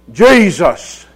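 loud, booming imitation of a big voice. Preacher imitates a man standing up in church, booming voice. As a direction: boom it out loud. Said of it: "with this booming voice".

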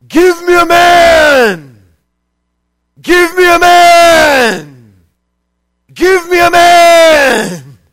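slow booming giant yell. Acted. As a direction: roar it out like a taunting giant. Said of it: "deep, scary sounding giant voice, and he yells out".

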